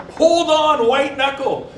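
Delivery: cling on and yell; mock coaster panic. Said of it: "And you're like going".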